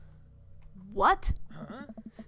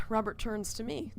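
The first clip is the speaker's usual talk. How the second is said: ordinary talk, nothing marked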